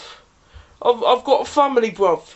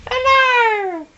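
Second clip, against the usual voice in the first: squeaky carrot hello; 12 semitones higher than usual. Pretend. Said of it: "Say hello, [name]".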